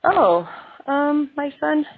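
high, innocent-sounding fib; nervous fib. Retold or imitated. Lying to her husband about the toys, high innocent voice. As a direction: play dumb and cover it up. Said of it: "And I'm like".

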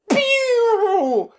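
very high squeaky pew; laser pew sound. Pretend. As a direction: fire a toy laser. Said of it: "Sound effects".

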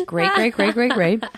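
ordinary delivery

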